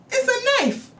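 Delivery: same high shriek on It's a knife; high shrieked disbelief; squealed high again. Shown as a demonstration. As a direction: repeat it high and shocked.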